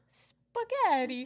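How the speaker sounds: loud, drawn-out mock Italian accent; Italian boys' accent. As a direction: stretch it out like an imitated Italian boy. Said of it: "these two Italian boys".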